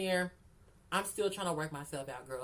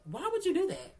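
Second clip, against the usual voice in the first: high, louder "Why would you do that?"; exasperated; 11 semitones higher, 4 dB louder than usual. Real, not acted. Repeating to Fran, a high exasperated "Why would you do that?". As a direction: throw it up in disbelief.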